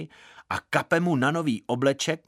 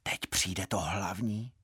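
low, soft confiding voice; hushed whispering; 4 semitones lower, 7 dB quieter than usual. A narrator's reading. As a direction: narrate it softly, as if whispered in church. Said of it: "Eva mě šeptala". (tiše, jakoby šeptem)

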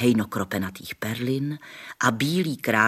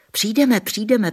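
put-on high, bright children's chorus, louder; eager children agreeing; 11 semitones higher, 7 dB louder than usual. A narrator's reading. Children promising the princess, eagerly high. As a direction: chime in like eager kids. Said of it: "přisvědčili děti".

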